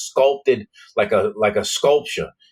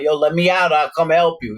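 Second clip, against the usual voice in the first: higher-pitched retold call to be let out; eager pleading; 9 semitones higher, 3 dB louder than usual. Wild teenager calling from his locked cell, eager. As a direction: act out an eager young offer of help.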